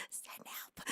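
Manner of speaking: soft unvoiced whisper; whispered plea. Real, not acted. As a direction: breathe it out, spent. Overwhelmed streamer, joking plea.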